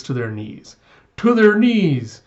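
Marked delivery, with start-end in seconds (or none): 1.2-2.2 loud, high, drawn-out battle cry; fervent rallying shout. Retold or imitated. Plotter vows to humble Western enemies, rallying shout. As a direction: shout it like a fanatic. Conspirator rallies with repeated slogan.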